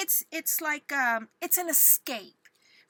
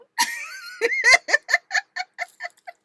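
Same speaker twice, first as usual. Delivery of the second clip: very high, loud peal of laughter; squealing fit of giggles; giddy laugh; 9 dB louder than usual. Real, not acted. Failing to calm down, giddy laughter.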